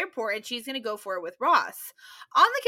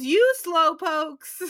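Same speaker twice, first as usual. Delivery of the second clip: very slow, stretched mock taunt; drawn out tease; 4 semitones higher, 4 dB louder, much slower than usual. [name] teasing the late arrivals, slow stretched mock taunt. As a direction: slowly drag out the teasing words.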